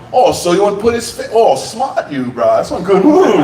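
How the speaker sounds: high-pitched impressed cop voice; local cop. Retold or imitated. Local cop admires the bouncer's move, high-pitched impersonation. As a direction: imitate a local cop catching on. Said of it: "local cop".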